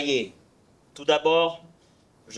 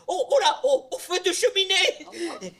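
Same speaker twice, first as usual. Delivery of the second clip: high, laughing mock cry of alarm; neighbours shouting; 13 semitones higher than usual. Neighbours crying out at the smoking chimney nose, high and laughing. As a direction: squeal like a panicked neighbour, laughing. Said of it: "les voisins crient".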